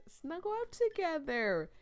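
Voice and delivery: high, loud, excited pick; excited exclamation. Real, not acted. Player picking the cuddle option, loudly excited.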